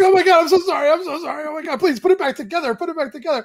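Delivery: high-pitched, pleading panic, played for laughs; mock frantic apology. Husband apologizing to crying wife, mock frantic. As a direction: beg in a hammed-up high voice.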